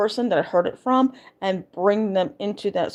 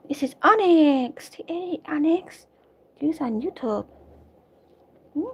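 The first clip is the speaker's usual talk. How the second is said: cooing pet talk; 8 semitones higher than usual. Real, not acted. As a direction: coo at the kitten lovingly. Cooing at her cat.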